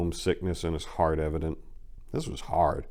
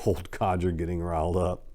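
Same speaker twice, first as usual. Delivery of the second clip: plain reading voice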